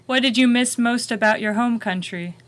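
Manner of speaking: ordinary talk, nothing marked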